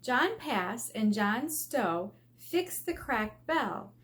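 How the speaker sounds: ordinary delivery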